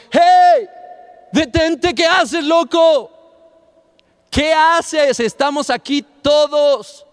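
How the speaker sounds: high, alarmed yell to stop someone; urgent shout. Acted. Prisoner calling to the jailer about to kill himself, urgent shout. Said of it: "de repente oye una voz".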